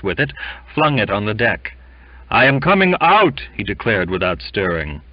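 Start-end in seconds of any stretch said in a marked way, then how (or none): 2.3-3.4 high, loud announcement; raised, emphatic insistence; defiant declaration. A narrator's reading. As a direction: declare it stubbornly. Sick man's defiant declaration.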